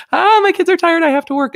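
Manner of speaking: high, loud exasperated whine; mock frazzled parent. Imitating tired millennial parent.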